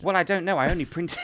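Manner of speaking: normal talking voice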